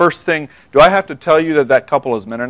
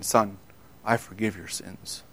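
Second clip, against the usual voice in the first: soft and low, barely above a breath; gentle hush; 8 semitones lower, 15 dB quieter than usual. Acted. Jesus speaking to the paralytic, hushed and tender. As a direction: forgive him tenderly, close and quiet. Jesus quietly forgives sins.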